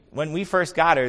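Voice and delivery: ordinary delivery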